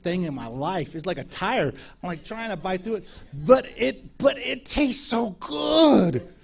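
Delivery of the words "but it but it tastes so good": high, very slow savouring; lightly hammed-up delight over tough chicken. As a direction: stretch it out like tasting something wonderful.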